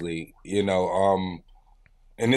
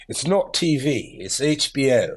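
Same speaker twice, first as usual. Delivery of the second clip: put-on measured British-accented TV promo voice. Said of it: "he was British".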